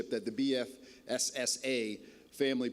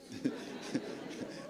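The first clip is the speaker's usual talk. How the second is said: soft laugh; 7 dB quieter than usual. Real, not acted. Laughs at shirt joke.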